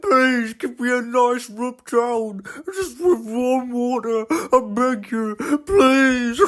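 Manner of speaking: high, slow pleading car voice; freezing car begging. Acted. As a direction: beg for a warm rub down. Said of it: "He was so cold".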